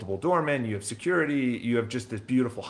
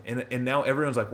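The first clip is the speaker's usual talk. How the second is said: ordinary delivery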